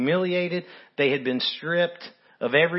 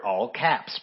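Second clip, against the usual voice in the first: ordinary talk, nothing marked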